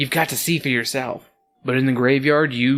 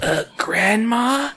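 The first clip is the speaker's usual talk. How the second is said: put-on timid child; 8 semitones higher, much slower than usual. Scared child calls to grandmother.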